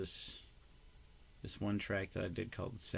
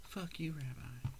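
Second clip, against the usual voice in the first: slow, softer teasing whisper; slow, softer, fond whispered sign-off; whispered mock insult; 4 semitones higher, 6 dB quieter, slower than usual. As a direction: breathe the jab out fondly.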